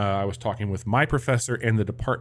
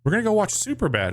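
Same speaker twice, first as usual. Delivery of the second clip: eager student; 10 semitones higher, faster than usual. Retold or imitated. His own younger self voiced.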